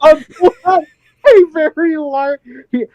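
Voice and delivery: slow, high, broken by laughter; laughing hard. Real, not acted. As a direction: barely get the words out laughing. Laughing hard mid-sentence.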